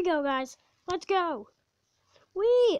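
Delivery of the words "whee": excited whee. Real, not acted. Roller coaster drop.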